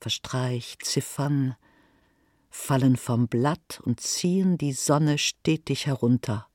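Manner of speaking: ordinary delivery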